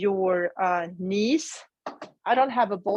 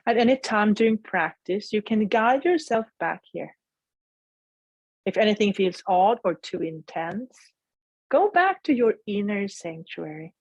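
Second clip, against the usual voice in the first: guided meditation. Acted. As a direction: gently guide them inward.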